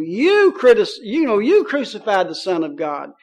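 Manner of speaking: forceful preaching, each "you" punched loud; emphatic charge, building to loud jabs; retold accusation. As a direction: imitate an apostle accusing a crowd. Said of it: "He pointed his fingers at these people".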